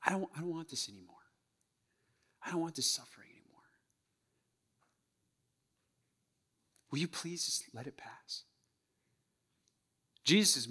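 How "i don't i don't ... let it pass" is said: understated, softly pleading prayer; agonized plea. Acted. Suffering child praying to Father God for relief, agonized plea. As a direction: plead quietly, with long pauses between requests. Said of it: "in our agony, we pray to him".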